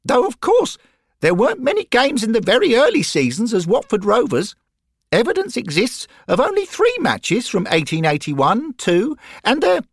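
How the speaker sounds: put-on high, bright young boy voice; eager schoolboy piping up; high treble of a keen boy; boy's voice. Derek reciting facts eagerly.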